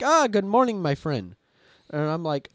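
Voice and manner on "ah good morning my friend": imitating a chatty AI, "ah" high and loud, then settling; hearty mock greeting from a chatbot; AI character greeting. AI character greets its user with the banned phrase, mock hearty. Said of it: "He's like".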